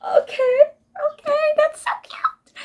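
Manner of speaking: tiny, very high-pitched squeal; gushing squeal. Retold or imitated. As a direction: imitate yourself melting, in a high squeak. Retells gushing at cute ending.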